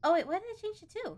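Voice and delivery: high, loud startled question; puzzled by a misclick; surprised at own move. Real, not acted. Player catching own mistake, startled.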